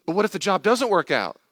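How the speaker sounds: high, loud worried whine; anxious objector. Acted. Anxious person worrying about the job, high mock panic.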